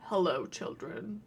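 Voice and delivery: low, slow, hushed creepy robot greeting; a sinister AI teacher greeting a class, slow and hushed; mock creepy greeting. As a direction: make it sound unsettling, drawn out. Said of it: "the most normal thing to say to a group of kids".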